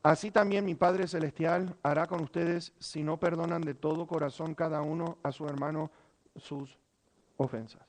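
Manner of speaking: a fading, solemn scripture reading; solemn hush. Acted. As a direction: let the warning sink in quietly. Solemn verse read, fading away.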